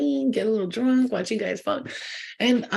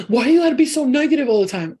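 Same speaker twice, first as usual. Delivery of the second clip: mock friend; 6 semitones higher, 7 dB louder than usual. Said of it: "And they're like".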